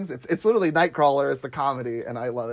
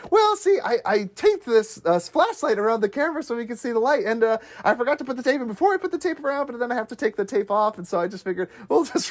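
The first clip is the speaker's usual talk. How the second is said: higher, flustered excuses tumbling out; hammed-up sheepish explaining; rambling Charlie; 7 semitones higher than usual. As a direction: talk yourself into a hole, nervous. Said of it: "He's like".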